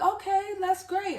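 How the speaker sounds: high, chirpy office-staff voice; mock-agreeable, high-pitched clerk; imitating leasing staff. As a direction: pitch it way up like a polite receptionist. Voicing leasing office staff's reply.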